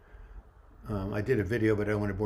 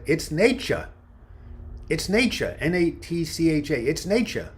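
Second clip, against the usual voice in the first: put-on raised, higher New York accent on nature; 6 semitones higher, 4 dB louder than usual. Counselor telling kids it's nature, New York accent. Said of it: "That's how they say nature in New York".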